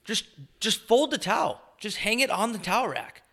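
high-pitched exasperated plea; exasperated pleading. Real, not acted. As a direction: plead in a high voice for basic tidiness. Exasperated plea about towels.

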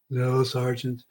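barely enough breath left to refuse; weak, drained reply. Retold or imitated. Parched trainee answering the instructor offering beer, drained and soft.

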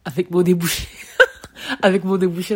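breaking into laughter while talking; laughing. Real, not acted. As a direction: laugh at your own blocked-nose sound. Laughing at her botched word. (en riant de soi-même)

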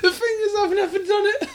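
high, slow, tearful laughing; talking through tears of laughter; crying with laughter. Real, not acted.

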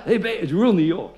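usual speaking voice

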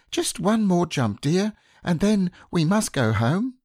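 high, gentle mother kangaroo voice; high motherly Kanga. A narrator's reading. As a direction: coax like a fond mother kangaroo.

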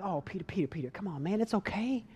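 soft, hushed comforting; calm Peter down gently; soothing. Retold or imitated. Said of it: "I'd be wanting to be like".

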